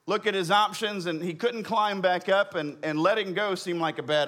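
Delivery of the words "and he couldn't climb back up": usual speaking voice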